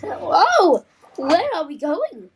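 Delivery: high, loud startled whoa; startled cry. Acted. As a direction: shout in alarm. Train suddenly speeds off.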